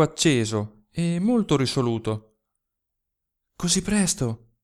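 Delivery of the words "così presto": trembling. Acted. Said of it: "disse Malia tremante".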